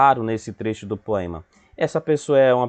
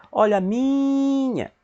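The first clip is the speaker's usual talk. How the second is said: high, long drawn-out MI in "minha"; street vendor call; 13 semitones higher, slower than usual. Shown as a demonstration. Teacher showing pupils the stressed syllable, sung-out vendor cry. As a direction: hawk it like a street seller. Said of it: "faz o vendedor".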